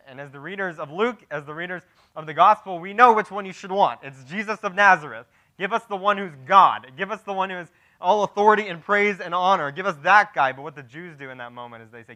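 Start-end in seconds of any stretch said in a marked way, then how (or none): 0.1-10.5 raised, loud preacher's appeal; higher-pitched, driving sermon delivery; impassioned preaching. Acted. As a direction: plead the case forcefully to the congregation. Preacher urges the right choice.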